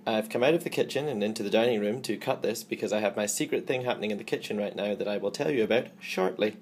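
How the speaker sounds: ordinary talk, nothing marked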